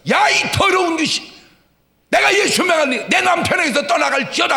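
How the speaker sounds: shouted exorcism command; rebuking shout. Retold or imitated. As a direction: yell at the evil spirit with full force. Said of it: "소리를 버럭 질러버리라고".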